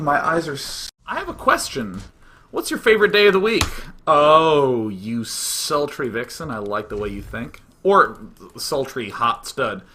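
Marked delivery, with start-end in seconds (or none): 4.1-9.8 low, slow, playful come-on; sultry put-on flirting; mock flirty. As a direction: purr it low in pretend seduction.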